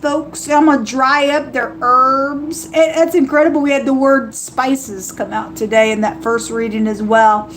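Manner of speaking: normal talking voice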